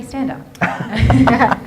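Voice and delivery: ordinary talk, nothing marked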